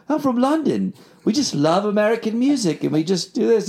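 high, hammed-up London accent; English accent. Musician bluffing the radio host about London, fake English accent. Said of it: "I'm speaking in an English accent".

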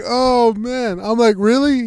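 high, loud disbelief; worked-up dismay. Retold or imitated. Storyteller reacting to the rat sighting, excited dismay. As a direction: react with mock alarm, voice pushed up.

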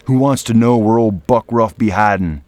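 loud gruff mountain man snarl; growled. Acted. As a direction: bark it like a rough brawler. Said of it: "he growled".

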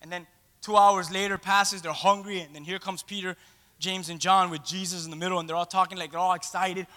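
ordinary delivery

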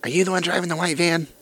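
lightly voiced crabby woman. Crabby homeowner confronting a delivery driver, crabby. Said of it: "old crabby ass woman comes out".

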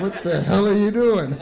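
loud retold shout; yelling at him. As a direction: bark it across the rocks at a stranger. Stranger yelling at dog owner.

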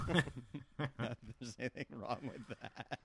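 drawn out slowly through laughter; laughing echo. Real, not acted. Echoing a bandmate's quip, laughing. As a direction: laugh along while slowly repeating the joke.

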